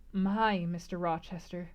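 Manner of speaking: plain reading voice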